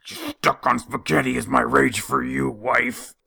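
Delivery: hammed-up seething at the wife; half-joking scrubbing fury; mock dish rage. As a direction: grumble at the dish as if it were the wife.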